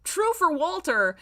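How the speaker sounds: high, slow, drawn-out mock remark; slow, high teasing aside; amused aside. Real, not acted. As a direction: stretch it out, amused.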